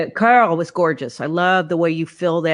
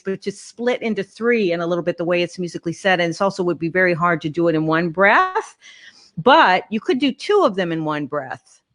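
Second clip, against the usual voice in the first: ordinary talk, nothing marked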